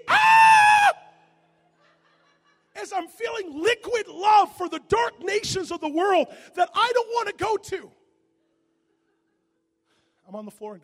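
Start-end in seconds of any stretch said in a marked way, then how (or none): 0.1-0.9 piercing, very high, loud scream; girlish scream. Retold or imitated. Preacher reenacting falling under the power of God, girlish scream. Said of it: "twelve-year-old girl that is screaming". 2.7-7.9 screamed retelling. Retold or imitated. Preacher feeling love for dark nations, hammed-up shouted wail.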